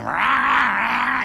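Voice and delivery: high, loud engine noise; mock engine revving. Patient on the bed imitating a Ferrari engine. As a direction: rev like a car.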